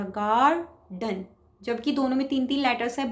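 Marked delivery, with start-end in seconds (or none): 0.0-1.2 "garden" with गार stretched long, pause, short डन; drawn-out stressed गार. Shown as a demonstration. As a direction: pull out गार in "garden", then a brief डन. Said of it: "लंबा सा खिंचा हुआ है थोड़ा".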